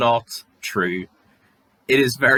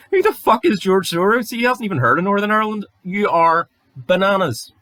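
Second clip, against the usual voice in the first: loud, high, exasperated yelling; a real exasperated rant at the troll; incredulous shout; 9 semitones higher, 4 dB louder than usual. Real, not acted. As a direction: bellow your disbelief at them. Berating imagined troll.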